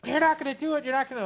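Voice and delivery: high, panicked protest in imitation; mock freak-out. Partner threatened with a mouse trap, mock freak-out. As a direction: imitate someone refusing to believe it. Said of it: "and they freak right out".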